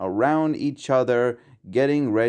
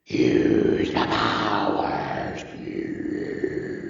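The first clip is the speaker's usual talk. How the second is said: low, slow eerie whispering voice; a sinister spirit beckoning; evil voice; 12 semitones lower, much slower than usual. A narrator's reading. The evil voice calling Blue in the night, menacing.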